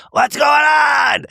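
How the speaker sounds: loud, high, slow rasp; raspy Kyle impression. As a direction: rasp it out like a heavy smoker. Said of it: "Kyle Richards raspiness".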